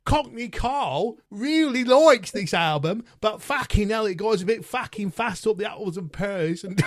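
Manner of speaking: a playful Cockney send-up, breaking into laughs; Cockney impression. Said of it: "do what Carl in his Cockney accent".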